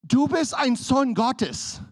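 loud, high, urgent preacher; fiery preaching. Acted. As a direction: preach it to the congregation. Preaching at full force.

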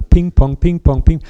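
bat the words back and forth like a ball; ping pong sounds. Pretend. Preacher mimics table tennis for the congregation, loud and bouncy. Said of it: "Liebestischtennis miteinander".

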